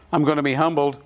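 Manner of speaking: ordinary talk, nothing marked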